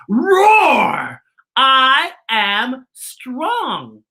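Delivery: loud, high mock roar then slow boast; playful roar. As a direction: roar like a brave kid hero. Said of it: "lifting up the stuffed bear saying".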